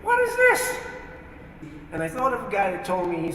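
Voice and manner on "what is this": shocked. Retold or imitated. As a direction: jump up in pitch, caught off guard by your own words. Shocked at his own prayer.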